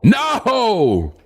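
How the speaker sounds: mock-anguished wailing, high and drawn out; 과장된 절규. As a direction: cry "no" like a melodramatic loss. Mock horror at changed AI voice.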